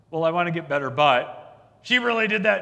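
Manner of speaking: a sulky spouse still blaming the partner; mock grumbling excuse. Couple grumbling to Dr Phil.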